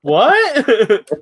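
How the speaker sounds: laughing mock shock; shocked laughing what. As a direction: yelp it in laughing disbelief.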